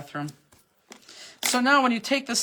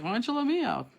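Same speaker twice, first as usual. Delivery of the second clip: lightly put-on pet voice; voicing the dog; 3 semitones higher, 5 dB quieter than usual. Owner voicing her pet's complaint, a lightly put-on voice. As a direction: speak for the cat, a mild complaint. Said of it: "She's like".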